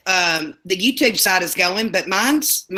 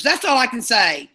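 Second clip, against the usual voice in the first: ordinary talk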